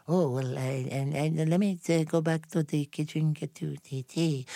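put-on a light Slavic accent on the waitress's words. Retelling the waitress's reply, light Slavic accent. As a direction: mimic the flustered waitress lightly. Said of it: "she said in her Slavic expression".

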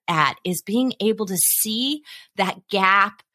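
ordinary delivery

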